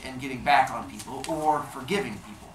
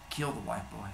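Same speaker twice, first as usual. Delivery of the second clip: real soft; 5 semitones lower, 10 dB quieter than usual. Acted. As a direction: lower and softer, a cold soft verdict. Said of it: "And real soft".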